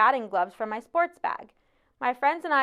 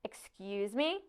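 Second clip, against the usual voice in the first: slow, quieter "excuse me?"; affronted teacher; 9 dB quieter, slower than usual. A narrator's reading. Teacher challenges a pupil's refusal, affronted. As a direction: draw it out softly as an offended challenge. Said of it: "Her eyebrows are twitching like electrified caterpillars".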